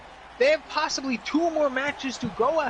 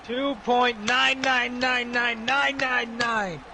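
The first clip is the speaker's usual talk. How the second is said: drawn-out count; much slower than usual. Real, not acted. Drawn-out exaggerated near-fall count.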